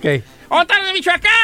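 stage host rousing an audience; own crowd call. Shown as a demonstration. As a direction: call out clearly to the whole crowd. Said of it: "Yo digo".

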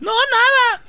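high, loud falsetto denial; wife's denial. Acted. Wife reassuring suspicious husband, falsetto denial. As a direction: deny it in a squeaky woman's voice.

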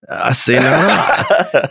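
slow mock-Southern protest; hammed-up Southern accent, stretched out; Southern gentleman. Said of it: "that Southern gentleman".

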